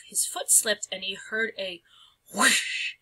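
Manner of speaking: usual speaking voice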